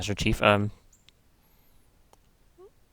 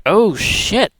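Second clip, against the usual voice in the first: surprised; 7 semitones higher, 5 dB louder than usual. Retold or imitated. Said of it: "and you're like".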